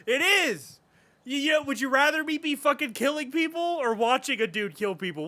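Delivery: high, loud, emphatic rant; worked-up, vehement outburst; getting mad. Real, not acted. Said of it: "I'm getting mad".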